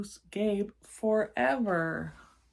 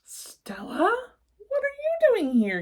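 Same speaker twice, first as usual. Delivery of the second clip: much higher, louder surprised question; 8 dB louder than usual. Acted. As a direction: gasp it out in amazement. Monster surprised.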